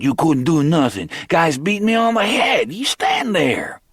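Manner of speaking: high, angry mimicry of Lionel; mocking Lionel's accent. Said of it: "Picking up Lionel's accent".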